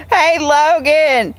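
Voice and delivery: ordinary talk, nothing marked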